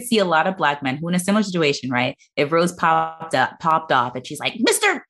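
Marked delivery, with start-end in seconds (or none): 4.6-5.0 high-pitched demand for attention. Acted.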